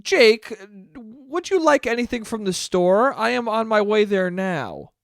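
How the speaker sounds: mock formal parent. Mocking how parents text their son, stiff and formal. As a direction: sound like a parent texting formally. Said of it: "they're like".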